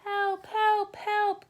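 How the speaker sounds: high, loud cry for help; truck crying for help. A narrator's reading. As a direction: call out in a panic, high. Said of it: "cried the little blue truck".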